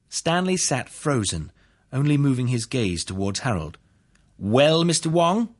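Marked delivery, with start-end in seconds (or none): 4.4-5.5 slow, high rising prompt; prodding prosecutor. A narrator's reading. Prosecutor to witness who sits frozen, prodding. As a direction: stretch it out expectantly to press for an answer.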